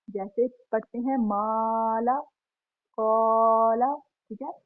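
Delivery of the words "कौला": कौला with the first vowel held long, said louder; long vowel demo. Shown as a demonstration. Teacher showing students how कौला is read, drawn out. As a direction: draw out the vowel in कौला clearly. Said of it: "हम उसको कौला पढ़ेंगे".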